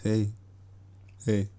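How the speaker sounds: ordinary talk, nothing marked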